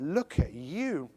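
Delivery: slow, drawn-out admiring croon; stretched-out, hammed-up adoring tone; mock self-admiration. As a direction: flirt with your own reflection. Lady admiring herself in the mirror.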